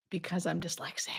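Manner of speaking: breathy confiding whisper; conspiratorial joking whisper; whispered aside. Real, not acted. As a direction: let it slip as a secret joke. Joking aside about mixed letters.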